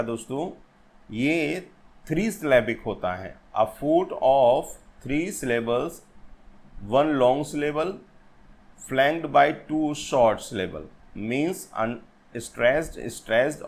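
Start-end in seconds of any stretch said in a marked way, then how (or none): 3.6-13.6 very slow, word by word, like dictating notes; slow dictation. Shown as a demonstration. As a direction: read it out slowly so students can write it down. Reading out a definition slowly.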